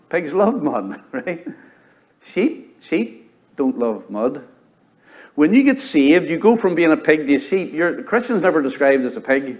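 usual speaking voice

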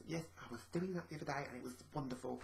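quiet, fast, deadpan sarcasm; sarcastic demo. Shown as a demonstration. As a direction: say it flatly so it means the opposite. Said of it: "They might talk like this".

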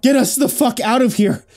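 high, desperate yell; scream. A narrator's reading. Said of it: "Thomas managed to scream".

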